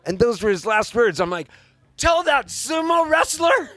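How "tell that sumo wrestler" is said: high, loud, drawn-out disbelief; incredulous shout. Retold or imitated. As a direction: shout it back in mock outrage. Son's outraged reply to last words.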